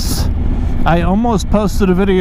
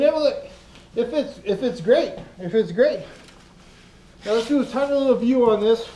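ordinary delivery

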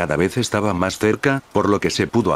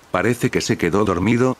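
ordinary delivery, nothing marked

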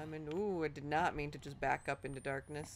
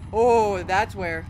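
sudden loud startled cry at the scare; startled shout; 16 dB louder, much slower than usual. Real, not acted. Gamer startled by a monster, loud startled shout. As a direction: yelp it out as the monster appears. Jump scare in horror game.

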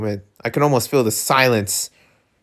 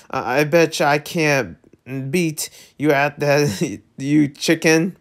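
plain reading voice

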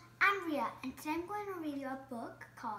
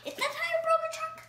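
much higher, fast and louder; eager guess; 11 semitones higher, 6 dB louder, faster than usual. Acted. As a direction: rattle it off high and eager.

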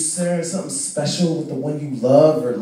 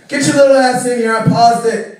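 put-on raised, louder call to the kid; exasperated dad; 8 semitones higher, 5 dB louder than usual. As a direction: give in and summon him, fed up. Dad calls son into the office.

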